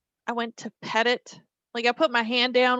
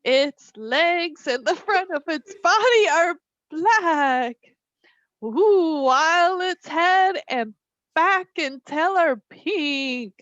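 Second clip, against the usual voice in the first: high, slow, loud ghostly read; spooky voice; 5 semitones higher, 5 dB louder, slower than usual. Pretend. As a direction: ham up the haunting. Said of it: "Should I read it in a spooky voice?".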